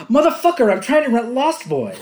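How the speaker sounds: high, loud mock irritation; acted movie outburst. Undercover cop to pestering customers, mock irate outburst. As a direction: blurt it high, mimicking the actor. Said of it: "the way he says it".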